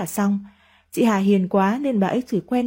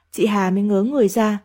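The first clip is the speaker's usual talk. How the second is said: plain reading voice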